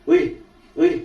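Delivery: loud calls into the dark; calling out. Retold or imitated. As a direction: shout to get his attention. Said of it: "saya bilang". (memanggil dengan keras)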